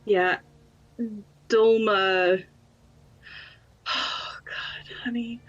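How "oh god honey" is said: very soft, breath-only aside; whispered dismay. Real, not acted. Player pauses before her character breaks down, real hushed whisper. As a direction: whisper it almost to yourself. Player reacts to emotional scene.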